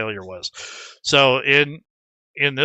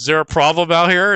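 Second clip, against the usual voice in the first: louder, faster bouncer challenge; a hint of a tough half-orc box boy stepping in; burly half-orc bouncer; 5 semitones higher, 6 dB louder, faster than usual. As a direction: step out of the back and call it out, a touch higher. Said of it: "he comes out and he says".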